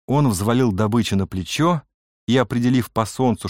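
ordinary delivery

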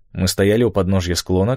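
usual speaking voice

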